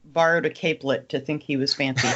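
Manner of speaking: normal talking voice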